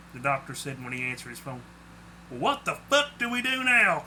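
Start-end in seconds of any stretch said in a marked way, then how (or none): 2.3-4.0 higher-pitched exasperated demand; indignant, worked-up question; angry. A narrator's reading. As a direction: ask crossly, pitch raised. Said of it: "Claude asked angrily".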